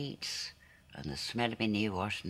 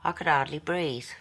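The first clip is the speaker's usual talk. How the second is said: raised, louder, elated remembering; 4 semitones higher, 5 dB louder than usual. Acted. Girl recalling running in the fields, so happy, elated. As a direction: relive the joy of a perfect day out loud. Reliving her happiest childhood day.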